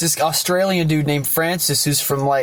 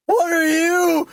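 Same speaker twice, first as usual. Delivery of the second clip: very high, drawn-out mock shock; shocked cry; slower than usual. Francis gaping at the crashed visitor, mock high-pitched shock. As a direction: stretch it out high, aghast. Startled human meets crashed alien.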